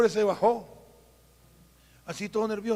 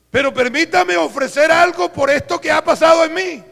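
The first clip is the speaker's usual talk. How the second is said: higher, louder insistent offer; eager loud plea; 7 semitones higher, 8 dB louder than usual. Retold or imitated. Rich man insisting to the pastor, higher and louder eager plea. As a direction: press the pastor with your gift.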